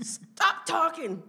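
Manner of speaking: high, loud, drawn-out command; imitated scolding. Mom silencing the nurse, mock angry scolding. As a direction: shut them up slowly, laughter underneath.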